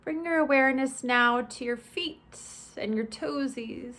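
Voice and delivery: measured, spacious body-scan instruction; guided meditation. Acted. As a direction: ease listeners through each step, stretching the phrase endings.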